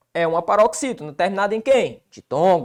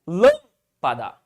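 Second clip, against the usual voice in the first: "Lâmpada" slow, with LÂM punched and pa-da dropping after; stressed first syllable; 5 semitones lower, much slower than usual. Shown as a demonstration. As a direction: hit LÂM in "Lâmpada", pause, then pa-da. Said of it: "sobre a antepenúltima sílaba". ("Lâmpada" com LÂM forte e pausa antes de pa-da)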